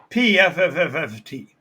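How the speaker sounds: spitting lip burst, pfft; explosion sound. Retold or imitated. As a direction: blow a wet raspberry burst through the lips. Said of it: "explosion sound that you".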